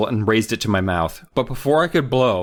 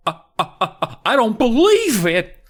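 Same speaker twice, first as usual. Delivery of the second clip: freaked out; 12 semitones higher, 5 dB louder than usual. Retold or imitated. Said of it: "he's just like".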